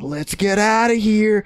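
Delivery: very high, squeezed-out slow plea; retold covert urging.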